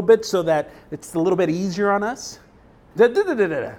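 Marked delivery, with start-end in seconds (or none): none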